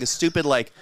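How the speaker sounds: ordinary delivery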